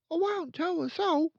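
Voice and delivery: put-on a curious little boy asking; young boy voice. Son asking his dad, high and eager.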